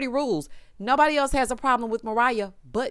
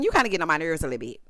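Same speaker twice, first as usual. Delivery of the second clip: ordinary talk, nothing marked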